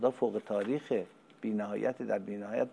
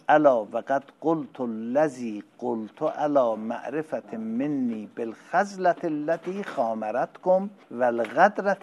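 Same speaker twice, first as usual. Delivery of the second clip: full-force preaching; 3 semitones higher, 7 dB louder than usual. Acted. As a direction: proclaim it to the whole hall. Preaching at full force, recites Arabic. (خطابهٔ پرشور، بلند و کشیده)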